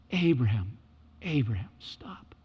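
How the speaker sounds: whispered call, fading to breath. Shown as a demonstration. God calling Abraham again, now whispered. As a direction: breathe the name, hushed. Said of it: "repeated softly as contrast".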